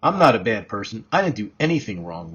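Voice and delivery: spat out. A narrator's reading. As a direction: mildly spit the words back in defence. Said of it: "Lauren spat".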